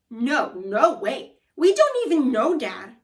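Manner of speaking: put-on a boy loudly denies knowing his dad; loud denial. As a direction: deny it loudly.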